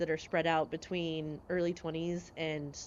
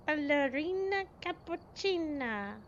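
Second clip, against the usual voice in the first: put-on higher, slow sing-song kid voice; imitating daughter; 8 semitones higher, slower than usual. Mother imitating her daughter's brain rot phrase, sing-song. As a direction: mimic the child drawing it out. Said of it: "that's my daughter saying".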